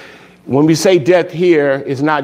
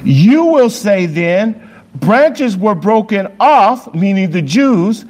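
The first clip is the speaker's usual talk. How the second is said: raised, slow pulpit reading; scripture reading; 7 semitones higher, slower than usual. Acted. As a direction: proclaim the verses slowly and high.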